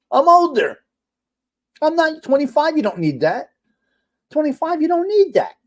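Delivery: high-pitched, loud comic outburst; indignant protest. Real, not acted. Streamer defending the pill box to chat, indignant. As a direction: protest at the top of your range.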